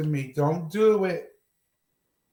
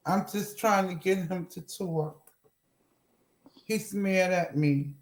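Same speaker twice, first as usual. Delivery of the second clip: normal talking voice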